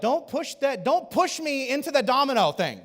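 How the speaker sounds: high, emphatic pushback; mock plea to back off; acted protest. Acts out protesting against pressure.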